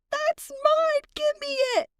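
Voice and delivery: very high, mock screeching child; screaming toddler tantrum. Three-year-old cousin grabbing at the nuggets, mock screaming. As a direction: imitate a bratty child's shrill-pitched demand. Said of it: "Screaming to the top of his lungs".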